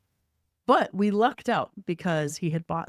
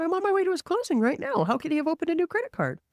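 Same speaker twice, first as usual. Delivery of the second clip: much higher pitch, disbelieving protest; retold incredulity; 12 semitones higher, faster than usual. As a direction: re-enact your disbelief in a high voice. Retelling her alarmed reaction.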